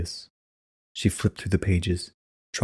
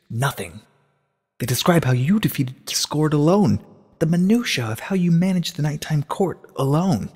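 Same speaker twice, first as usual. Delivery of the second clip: put-on higher, urgent young voice pressing questions; a worried student pouring out her discovery; Twilight, anxious and insistent; 8 semitones higher than usual. Twilight presses Celestia about the missing sister, anxious and insistent.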